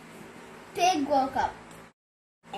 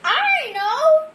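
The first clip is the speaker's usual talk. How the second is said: much higher, louder squeaky pig; pig's voice; 12 semitones higher, 8 dB louder than usual. Acted. Eager pig with an idea for the animals, high and loud. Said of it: "said pig".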